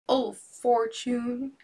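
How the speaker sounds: slow, high, loud cry to fate; fisherman cries out. Acted. Fisherman crying out to Fortune in despair. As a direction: drag out the plea to Fortune. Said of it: "he cried".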